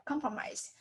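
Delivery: normal talking voice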